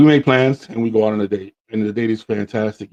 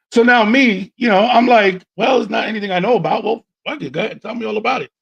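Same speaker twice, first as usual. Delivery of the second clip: excited; 9 semitones higher than usual. Retold or imitated. Excited raised voice retelling.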